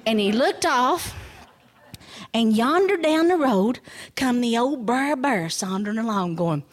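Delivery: usual speaking voice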